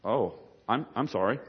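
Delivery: soft, hesitant acted apology; meek waiter. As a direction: back down quietly before the priest.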